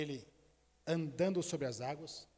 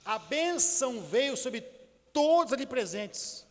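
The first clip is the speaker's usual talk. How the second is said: full-force preaching; 5 semitones higher, 5 dB louder than usual. Acted. Preacher proclaiming blessing over the congregation, fervent and loud. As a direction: proclaim it to the whole church.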